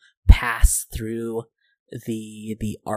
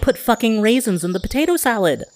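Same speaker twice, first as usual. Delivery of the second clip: indignant rant; 10 semitones higher, 4 dB louder, faster than usual. Acted. Character rants about raisins.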